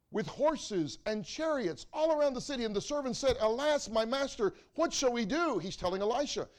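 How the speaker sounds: loud, ringing pulpit preaching that climbs as the story builds; preaching at full force. Acted. Preacher telling his church the Elisha story, loud and full. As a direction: preach it out big to the congregation.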